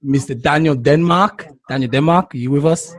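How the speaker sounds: ordinary talk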